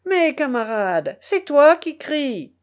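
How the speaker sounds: confident holler to a comrade; shouted reply. A narrator's reading. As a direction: throw it across the water. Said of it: "lança-t-il dans la direction".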